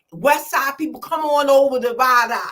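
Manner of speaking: loud call to the West Side. Real, not acted. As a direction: shout out to invite the crowd. Calling out to the city.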